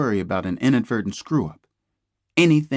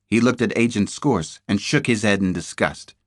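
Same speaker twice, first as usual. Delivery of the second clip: ordinary delivery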